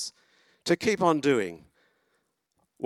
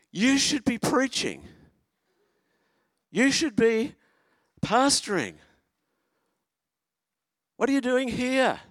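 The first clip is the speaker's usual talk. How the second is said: much higher, loud insistent plea; urgent friend; 13 semitones higher, 4 dB louder than usual. Retold or imitated. Old youth group friend urging the pastor in the street. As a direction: press him hard, almost shouting. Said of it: "he could say it like that".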